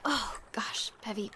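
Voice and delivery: unvoiced whispered exclaim. Dawn digging out of the cave-in, whispering. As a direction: breathe it out under your breath.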